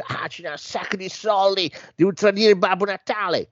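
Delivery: put-on high English-accented elf reply. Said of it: "che è un tizio inglese".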